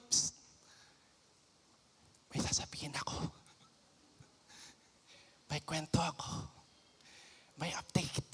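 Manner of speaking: hushed, breathless secret-sharing; gossip's whisper. Acted. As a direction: lean in and whisper the news. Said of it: "Kaya po pala yung".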